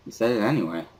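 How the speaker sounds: plain reading voice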